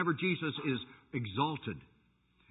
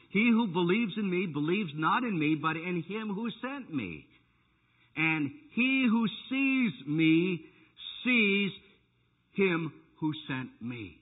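preaching at full force; 3 semitones higher, slower than usual. Acted. Preacher crying out Jesus' words to the church. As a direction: stretch each word like a proclamation.

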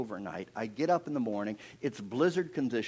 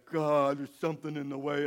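acted plea of a baffled man; distressed prayer. Moses starts to pray at the sea, pleading. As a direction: cry out to God, mildly pleading. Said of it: "he starts to pray".

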